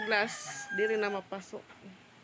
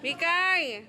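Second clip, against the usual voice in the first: calling out; 6 semitones higher, slower than usual. Real, not acted. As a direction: call her over across the yard. Calling the child from afar. (tinatawag nang malakas ang bata)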